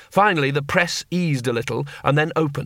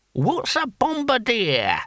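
high, drawn-out question; puzzled old barbarian; 10 semitones higher, much slower than usual. Acted. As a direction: ask slowly in a high character voice.

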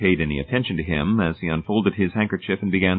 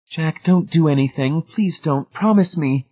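begging; 8 semitones higher than usual. A narrator's reading. Marcia begging Jack.

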